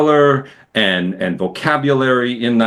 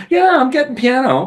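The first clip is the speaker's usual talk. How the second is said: higher-pitched mock listener reaction; lightly acted critic, pitched up; imagined listener; 13 semitones higher than usual. Imagined listener judging a Tennessee piano, pitched-up mock reaction.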